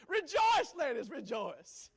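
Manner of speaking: high loud rejoicing cry, laughing; jubilant call. Real, not acted. Preacher laughing, urging ladies to rejoice. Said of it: "preacher exclaiming at congregation".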